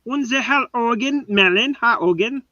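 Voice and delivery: hammy fake German accent; put-on German accent, overdone. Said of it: "a fake German accent".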